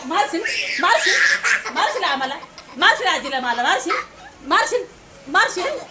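playful laughing repeated question, rising; laughing tease. Real, not acted. Mother teasing the girl, laughing and high. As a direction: tease her through laughter.

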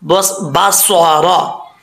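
बस बसारा drawn out, with a full heavy रा; slow recitation. Shown as a demonstration. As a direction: recite बस बसारा slowly, with a thick रा. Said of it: "रा पुर पड़ा जाएगा". (धीमी तिलावत, पुर रा के साथ)

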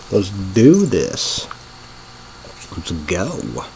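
ordinary talk, nothing marked

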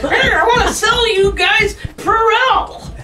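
high, loud, drawn-out mock sales pitch; hammy commercial pitch. Actor mocking the silly commercials they had to audition for, hammy sales pitch. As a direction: belt it out like an overeager ad mascot.

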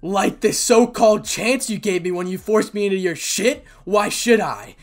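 really angry. Acted. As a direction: hurl accusations at those who betrayed you. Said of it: "Issei now getting really angry".

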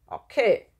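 loud, grudging growl; short, forceful answer to a dare; growled reply. A narrator's reading. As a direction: snarl it back. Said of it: "knurrte Elmar".

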